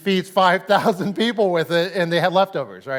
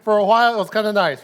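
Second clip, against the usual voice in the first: laughing; 5 semitones higher, 7 dB louder, faster than usual. Real, not acted. Laughing repeat of the joke.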